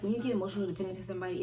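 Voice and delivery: ordinary talk, nothing marked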